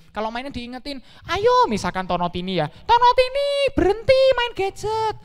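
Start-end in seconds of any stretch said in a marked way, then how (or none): 1.3-1.7 high, loud call; parent calling out. Acted. Parent calling children off gadgets. 2.7-5.2 high, loud call; parent calling out. Acted. Parent calling children off gadgets.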